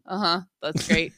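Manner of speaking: absent-minded low acknowledgement; distracted, low-key mock interest; imitating Jacob. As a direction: mumble along without really listening. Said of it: "and he's like".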